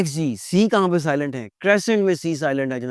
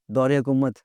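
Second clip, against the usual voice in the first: ordinary delivery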